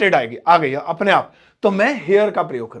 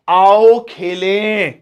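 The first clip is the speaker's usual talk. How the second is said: slow, louder drawn-out invitation; inviting; 6 dB louder, much slower than usual. Shown as a demonstration. Teacher demonstrating a slow invitation to play. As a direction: draw out the call to play.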